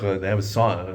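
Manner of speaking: normal talking voice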